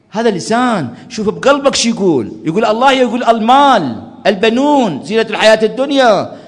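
loud, high, fervent sermon delivery; preaching at full force. Acted. Preacher urging mourners to feel God in their hearts, fervent. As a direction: drive it home to the congregation.

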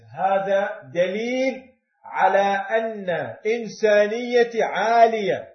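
loud preached climax on إنسانيتي; preaching shout. Real, not acted. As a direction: hit إنسانيتي hard, falling.

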